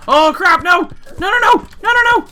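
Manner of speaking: loud, very high frantic "no, no, no"; panicked yelling. Real, not acted. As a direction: yell in rising panic as you nearly die. Near death in game again.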